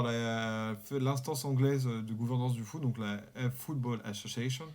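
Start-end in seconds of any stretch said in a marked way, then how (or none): 3.5-4.6 says Football Association in a light English accent. Shown as a demonstration. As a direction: show off a mock English accent on the English name. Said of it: "T'as vu, j'ai un bel accent".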